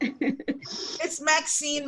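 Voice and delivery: "Maxine" drawn out very slowly, each syllable clear; name correction. Shown as a demonstration. Host gently correcting the guest's pronunciation of Maxine, slow and clear. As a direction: spell out "Maxine" slowly by ear, MAX-een. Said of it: "Max-, Maxine, right?".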